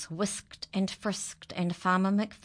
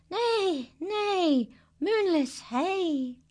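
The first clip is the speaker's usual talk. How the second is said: much higher, slow neighing chant; whinny the words like a horse; 4 dB louder, much slower than usual. Acted. Horse singing to the moon, high whinny.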